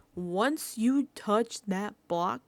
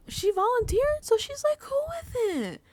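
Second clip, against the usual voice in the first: mocking high voice; 13 semitones higher, 6 dB louder than usual.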